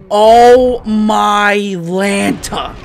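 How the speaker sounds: loud, very slow disbelief; drawn-out loud dismay; stunned exclamation. Real, not acted. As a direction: stretch every word out in shock. Stunned by an interception.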